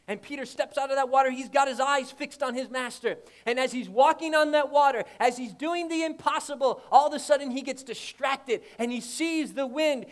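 fiery preacher telling of Peter sinking; full-force preaching. Acted. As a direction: preach it, building the story.

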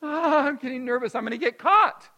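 a child's rising panic, voiced high and loud; nervous kid. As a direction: fret like a scared kid about to be caught. Child panicking in hide and seek.

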